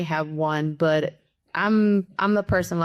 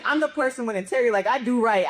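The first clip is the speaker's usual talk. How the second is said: usual speaking voice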